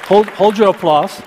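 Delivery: big, high, loud hammy announcer; milking the applause. Pretend. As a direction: play it up like a game-show host. Playful call to hold applause.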